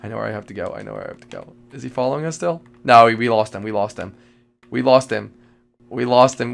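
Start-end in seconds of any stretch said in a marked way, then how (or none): none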